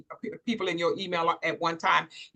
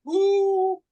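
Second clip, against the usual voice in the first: high excited ooh; 10 semitones higher, 4 dB louder than usual. Real, not acted. Host reacts.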